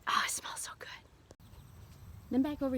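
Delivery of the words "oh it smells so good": breathy whisper. Real, not acted. Smelling the lemon balm.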